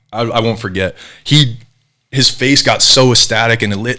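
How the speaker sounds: ordinary talk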